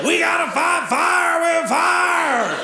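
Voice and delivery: slow, high, hammed-up macho tough talk; mock the gun nut's bravado; gung-ho American. Comic mocks gun lovers with slow, high, hammed-up bravado.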